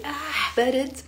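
high-pitched "ah" at the cold; demonstrated shiver-at-the-cold exclamation; reacting to cold. Shown as a demonstration. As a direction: cry out as if chilled. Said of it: "if it's cold, I say".

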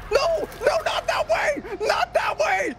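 high panicked yelling; panicked shout. Real, not acted. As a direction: scream it in panic. Teammate caught by killer.

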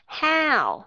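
"How" with a full, rounded ao glide; "How" said long and round; clear rounded how. Shown as a demonstration. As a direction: open the mouth wide on "How". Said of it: "đọc cho rõ ràng là ao".

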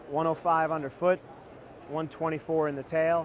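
ordinary talk, nothing marked

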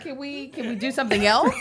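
soft, amused plea to change the subject; a quietly laughing request for something else. Retold or imitated. Friend mocking the girl's plea, soft and laughing. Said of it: "She's like".

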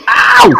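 high, loud "ow"; yelp of pain. Real, not acted. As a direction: cry out as if just hit. Gets hit in game.

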